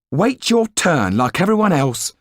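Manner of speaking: a lightly voiced stern landlady; wife's scolding voice. Proprietor's wife about to tell Yan to wait, mock scold.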